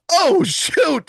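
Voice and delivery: high, startled retelling; acted alarm. Storyteller reliving the man freaking out, alarmed. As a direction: blurt it like things just went badly wrong.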